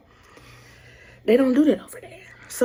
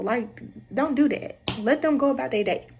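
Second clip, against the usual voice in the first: normal talking voice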